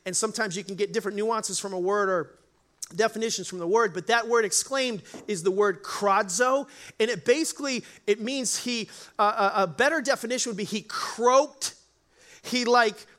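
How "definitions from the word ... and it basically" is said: usual speaking voice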